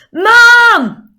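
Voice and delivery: very loud, high, long-held yell; child's shout for mum. Acted. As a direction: yell for Mum like a kid downstairs. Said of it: "altered it slightly to do my kids' voices".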